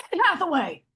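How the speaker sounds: loud, high call across a room; shouted name. Retold or imitated. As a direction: yell the name like calling a dog. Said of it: "shout at me like I'm a dog".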